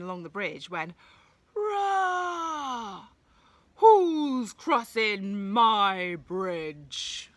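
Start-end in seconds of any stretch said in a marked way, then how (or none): 1.5-3.1 high, loud roaring cry; troll roar. Acted. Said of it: "growled the troll". 3.8-7.3 slow, drawn-out, loud troll voice; growling troll. Acted. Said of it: "growled the troll".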